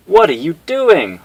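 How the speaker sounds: high loud alarmed cry; startled protest; alarmed question. Acted. Honda protesting intruders in the van, high alarmed cry.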